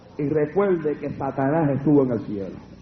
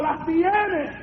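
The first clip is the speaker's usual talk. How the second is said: high-pitched mock yell; retold demon's cry; 10 semitones higher than usual. Preacher retelling the demon screaming in the room, high imitated cry. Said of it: "comenzó a gritar en el cuarto".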